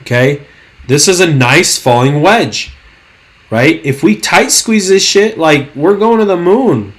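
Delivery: ordinary talk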